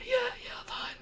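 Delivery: a high, choked whisper of a reply; strained croak. Retold or imitated. Boy answering his dad with a swollen throat, strained. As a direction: squeeze the words out through a tight throat. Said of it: "speaking like that".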